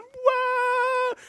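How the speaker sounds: high mock crowd squeal; crowd cheer. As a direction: squeal like an excited fan. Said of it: "everybody went".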